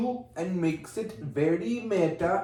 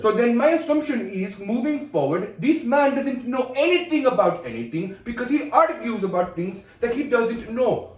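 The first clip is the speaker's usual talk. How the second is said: put-on higher, emphatic Indian accent; 5 semitones higher than usual. Accusing co-host of ignorance, high emphatic Indian accent. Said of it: "I know how good my Indian is".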